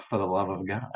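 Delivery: soft, low, unmoved reply; quiet mocking echo. Montresor echoes his victim's plea, quiet and low.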